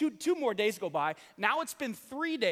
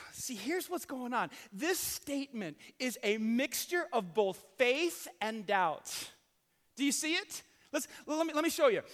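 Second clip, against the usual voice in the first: ordinary talk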